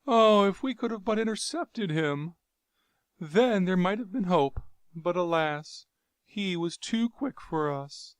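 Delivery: high, mournful regret; despairing lament. Acted. Jav lamenting their lost chance, despairing. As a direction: let hope drain away.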